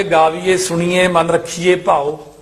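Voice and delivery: ਗਾਵੀਏ, ਸੁਣੀਏ ending in a close e; mistaken e endings. Shown as a demonstration. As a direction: demonstrate ਰੱਖੀਏ with a plain e instead of ai. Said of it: "ਪਰ ਜੇ ਪੜ੍ਹਨ ਵਾਲੇ ਕਹਿਣਗੇ".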